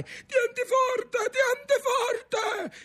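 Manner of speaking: very high, stretched-out cry; shouted warning. A narrator's reading. Grandfather to grandson hanging over the sea, shouting. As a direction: yell it through the crashing waves. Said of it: "gli gridava il nonno in quel fracasso delle onde".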